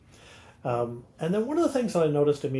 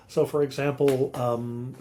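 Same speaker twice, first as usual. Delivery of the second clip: normal talking voice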